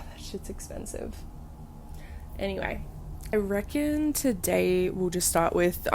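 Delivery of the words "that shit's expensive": unvoiced quiet aside; whispered aside. Real, not acted.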